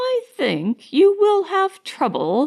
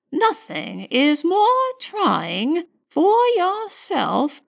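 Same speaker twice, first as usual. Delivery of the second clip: high, slow, grand-dame condescension; condescending; 9 semitones higher, slower than usual. A narrator's reading. Elder lady admonishing a new servant, condescending. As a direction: lecture from above, drawing out the words. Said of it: "becoming more condescending".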